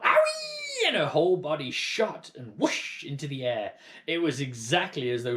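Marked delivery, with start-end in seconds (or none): 0.0-0.9 very high, loud shrieking yelp; yelp of pain. A narrator's reading. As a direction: shriek out as the medicine hits. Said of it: "Grandma yelled".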